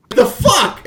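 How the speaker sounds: startled high shout; shocked. Retold or imitated. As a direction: blurt it out in shock. Party reacts to princess hair.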